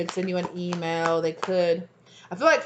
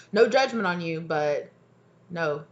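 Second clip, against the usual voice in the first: ordinary talk, nothing marked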